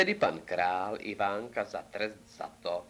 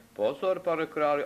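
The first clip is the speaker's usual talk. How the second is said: louder, higher warning cry; crowd calling out; 5 semitones higher, 5 dB louder than usual. A narrator's reading. Courtiers warning the king about Ivánek, raised and urgent. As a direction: call a warning over to the king. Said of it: "zvolali všichni".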